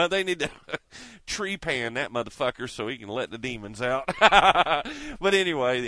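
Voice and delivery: talking through real laughter; laughing. Real, not acted. Man joking that they should let his demons out, laughing. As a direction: crack up at your own joke. Cracking up at own joke.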